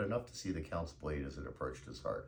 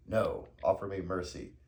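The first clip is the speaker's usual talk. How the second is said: louder, higher command; forceful demand; 4 semitones higher, 6 dB louder than usual. A narrator's reading. Avenger ordering the Count to beg, forceful.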